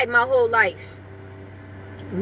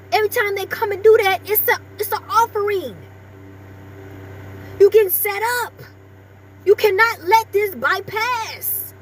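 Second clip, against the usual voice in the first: light preacher cadence, a bit higher and driven; preaching; 7 semitones higher than usual. Acted. Vlogger preaching to viewers about blessings, mild sermon cadence. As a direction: testify to the viewers like a small sermon.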